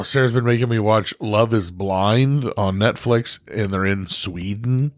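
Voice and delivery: ordinary delivery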